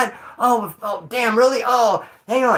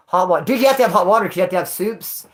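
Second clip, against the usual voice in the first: ordinary talk, nothing marked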